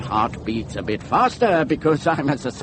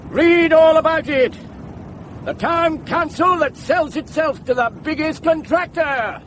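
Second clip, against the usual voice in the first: high, ringing headline shout; newsboy cry; 12 semitones higher than usual. Acted. Campaigner hawks scandal about the town council, newsboy cry. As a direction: call out the headline like a street paper seller. Cries headlines like a newspaper seller.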